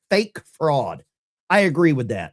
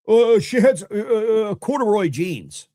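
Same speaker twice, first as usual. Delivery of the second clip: mocking imitation; 4 semitones higher, 3 dB louder than usual. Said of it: "Why would she bring up corduroy jeans?".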